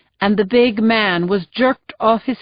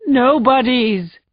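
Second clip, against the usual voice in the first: slow broken repeat; 4 semitones higher, slower than usual. Acted. Husband repeating to the sergeant, broken and slow. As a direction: let the word collapse. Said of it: "before shouting".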